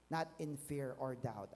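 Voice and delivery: ordinary talk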